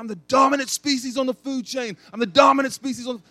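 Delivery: high, loud triumphant yell; fighting a bear in a dream, yelling high and loud. Retold or imitated. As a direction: roar it out like you're winning the fight. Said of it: "I remember yelling in my dream".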